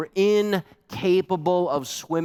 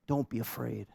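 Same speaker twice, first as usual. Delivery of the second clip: soft, hushed and reassuring; a soothing near-whisper of comfort; hushed reassurance; 2 semitones lower, 10 dB quieter than usual. Acted. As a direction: say it gently to frightened friends. Quoting Jesus comforting disciples.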